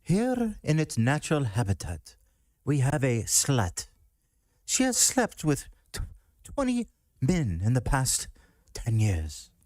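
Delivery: high, hushed wildlife narrator voice; nature documentary. Retold or imitated. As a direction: narrate like a BBC wildlife film. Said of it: "being narrated by ... The B B C guy".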